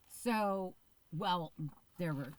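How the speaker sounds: talking through hiccups; interrupted by hiccups. Real, not acted. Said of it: "I'm getting the hiccups".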